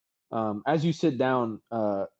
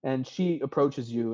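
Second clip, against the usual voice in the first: normal talking voice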